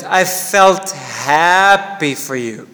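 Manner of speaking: slow demo, "felt" given the first strong beat, "happy" carries the fall; slow teaching read, "felt" stressed. Shown as a demonstration. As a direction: spell it out slowly, leaning on "felt" then falling on "happy". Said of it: "imagine I said like this".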